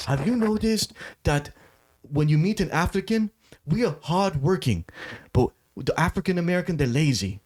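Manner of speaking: put-on quoting the African man, lightly in his accent; African accent impression. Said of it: "doing an impression of the African man".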